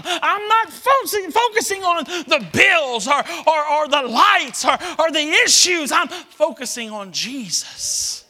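loud, high, shouted preaching; full-force preaching. Acted. Preacher shouting to the congregation, full force. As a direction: preach it out to the whole church.

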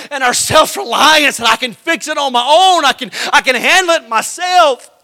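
high, loud revival preaching; full-force preaching. Acted. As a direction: lift the whole church with it.

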